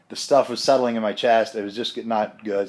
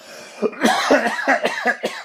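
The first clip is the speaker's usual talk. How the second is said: chesty cough. Real, not acted. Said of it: "Excuse me".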